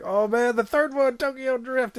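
high, loud eager gush; excited. Retold or imitated. Kids hyped for the third movie, excited. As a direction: hype it up like a kid.

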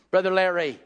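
ordinary talk

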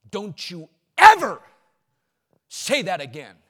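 loud, stern warning, hit ever; imitating a friend scolding; a friend in your face, firm correction; stern rebuke. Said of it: "he said".

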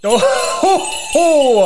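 loud high Santa laugh; jolly ho ho ho. Acted. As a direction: boom out a hearty ho ho.

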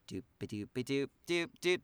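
light doodling filler noise, a bit singsong; idle doop-doop vocalizing. Real, not acted. Co-host trying to recall what is next, idle doop-doop filler. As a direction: doop along while searching memory. Fills time while recalling a list.

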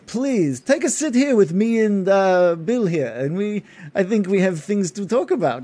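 a courteous noble inviting them to sit; Gindar's voice. Acted. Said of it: "and he says".

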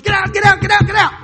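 frantic yelled warning, high and loud; urgent shout. Retold or imitated. Staff banging on doors, shouting everyone out. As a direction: bang on doors yelling them out. Retelling hotel evacuation alarm.

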